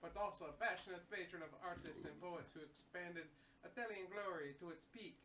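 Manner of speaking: formal reading. Acted. Reads box text aloud.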